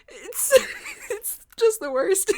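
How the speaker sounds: high sobbing, words dragged out; crying. Real, not acted. Mother to her friend about baby's hospital stay, crying. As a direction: gasp it out between sobs and weak laughs. Breaks down after retelling.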